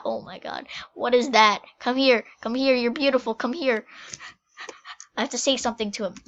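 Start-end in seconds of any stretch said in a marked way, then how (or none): none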